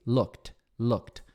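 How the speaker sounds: Looked said "lukt", ending in a crisp t; Looked with a clear final t sound; pronunciation demo. Shown as a demonstration. Teacher showing students the final t, Looked ending in t. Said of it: "Este passado tem som de T".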